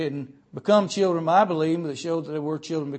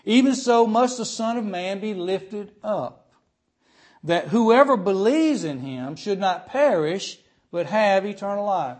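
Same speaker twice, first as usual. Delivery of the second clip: scripture reading; 3 semitones higher, 4 dB louder than usual. Acted. Preacher reads scripture aloud.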